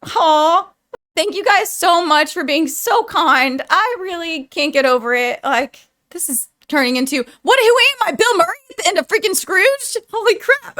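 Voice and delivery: gushing thanks, choked up and excited; grateful youtuber getting emotional with her viewers. Real, not acted. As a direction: voice jumps high with emotion. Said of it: "Now I'm gonna get emotional".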